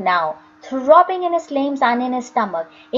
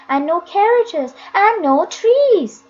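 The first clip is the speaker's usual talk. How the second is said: rising higher, slower and insistent; 7 semitones higher, 3 dB louder, slower than usual. A narrator's reading. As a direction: push each "no" harder, convincing him. Jim insisting to Joseph.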